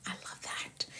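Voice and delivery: soft whispered aside. Real, not acted. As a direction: breathe it almost silently.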